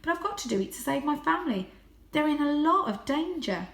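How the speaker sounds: voiced high and light as a young cat; a proud little cat boasting. A narrator's reading. Young Varjak boasting his breed to the stray.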